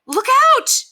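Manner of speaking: high, loud cry of warning; shouted warning. A narrator's reading. Woman warns Henry of the dog's mouth, shouting. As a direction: shout it urgently, pitch right up. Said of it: "shouted the woman".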